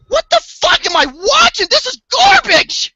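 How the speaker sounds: high, loud, disgusted yell; exasperated outburst. Retold or imitated. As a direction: shout it in disbelief at the screen. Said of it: "I'm like".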